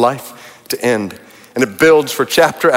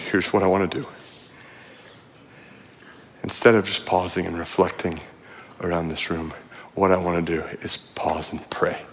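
hushed prayer voice; 7 semitones lower, 11 dB quieter than usual. Acted. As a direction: draw the room into quiet prayer.

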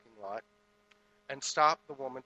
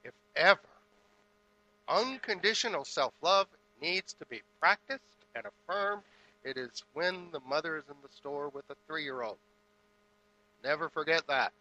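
full-force preaching; 3 semitones higher, 5 dB louder than usual. Acted. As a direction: hammer it home from the pulpit.

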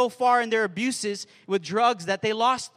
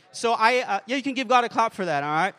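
usual speaking voice

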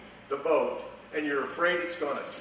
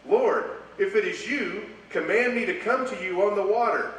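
higher and louder, calling out; bold plea; 6 semitones higher, 5 dB louder than usual. Acted. Peter calling out to Jesus on the water, loud and higher. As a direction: call across the water to Lord. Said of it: "Peter answered him and said".

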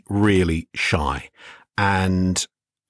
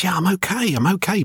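put-on small child's voice, pitched up; lightly voiced young boy, pitched up; 9 semitones higher than usual. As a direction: play the sick little boy saying he's fine. Sick child reassures brother.

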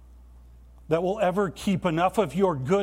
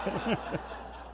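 soft laugh; 4 semitones higher, 6 dB quieter than usual. Real, not acted. Laughing at the movie scene.

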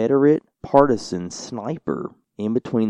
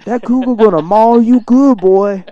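much higher, louder hammed-up Louisiana drawl; thick Cajun accent; 14 semitones higher, 10 dB louder, slower than usual. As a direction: ham up the lady's Cajun warning to the boy. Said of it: "a very strange accent".

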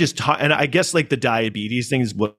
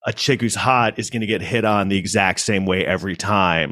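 normal talking voice

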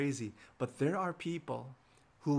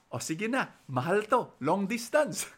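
mom cutting a costly call short, higher and louder; hurried mom; 6 semitones higher, 5 dB louder than usual. Retold or imitated. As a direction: play a mother anxious about the phone bill. Acts mom hurrying costly call.

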